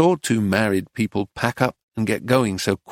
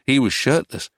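ordinary delivery

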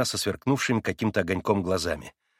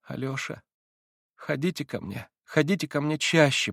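pleading; 5 semitones higher than usual. A narrator's reading. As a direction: plead with him to come back. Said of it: "проговорила она вдруг молящим голосом".

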